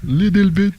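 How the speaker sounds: put-on English in a heavy French accent; mock French-accented reply. Answering the French woman in a thick French accent. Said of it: "And I was like".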